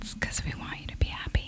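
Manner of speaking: very soft whispered sign-off. Pretend. Co-host joining the podcast goodbye, whispering. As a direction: join in the whispering bit.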